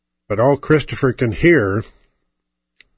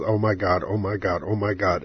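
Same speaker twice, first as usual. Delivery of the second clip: hushed, stunned under the breath; quiet to himself; 3 semitones lower, 7 dB quieter than usual. Retold or imitated. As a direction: say it softly to yourself in shock. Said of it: "All he can think to himself is".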